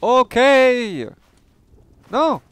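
loud, very high burst; astonished exclamation. Real, not acted. Gamer reacting to a discovery in the game, astonished. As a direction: cry out high and loud in disbelief.

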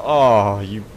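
usual speaking voice

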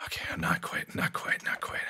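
breathless whisper while aiming. Real, not acted. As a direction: hush it, focused.

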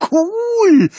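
high, loud squeal of delight; thrilled cool. Acted. Starstruck boy squealing about Cody's secret sign. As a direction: burst out with admiration. Kid gushing about Cody.

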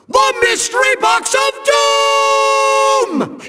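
high, drawn-out theatrical announcement; grand villainous proclamation. Acted. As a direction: proclaim it high and stretch out the last word. Villain announces his doom device.

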